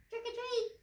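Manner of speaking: high playful sing-song; sing-song high trick or treat. Pretend. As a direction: lift it up high like a giggle. Playful exclamation to children.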